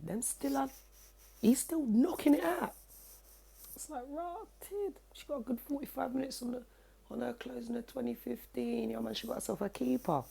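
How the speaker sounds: high amused voice. Retold or imitated. Mock commentary on neighbours' sex.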